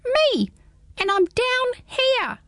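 high, small squeaky creature; a tiny curious hedgehog peering up; high little hedgehog, puzzled. Acted. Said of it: "said the odd little voice".

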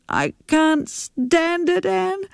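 high and slow, close to tears; sobbing. A narrator's reading. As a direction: plead through sobs. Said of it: "Jackie sobbed".

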